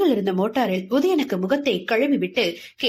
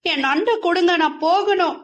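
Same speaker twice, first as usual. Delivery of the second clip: higher, teary pleading for the crab; teary plea; 7 semitones higher than usual. Acted. Girl begs the teasing boy for her crab, teary. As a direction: ask with tears welling up. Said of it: "கண்ணில் கண்ணீர் தழும்ப".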